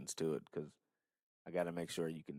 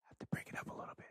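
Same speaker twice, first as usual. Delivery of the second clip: whisper; 7 dB quieter, faster than usual. Pretend. Teasing listeners with private talk.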